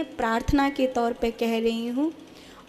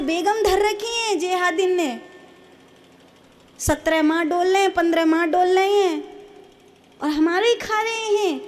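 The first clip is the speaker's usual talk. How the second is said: high, strained tirade; impassioned preaching; 6 semitones higher than usual. Acted. Preacher railing at her listeners in a high, heated voice. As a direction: pitch it high and keep hammering.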